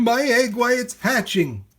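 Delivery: high, loud cry of wonder; joyful shout. A narrator's reading. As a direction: shout it out in amazement. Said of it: "shouted Horton".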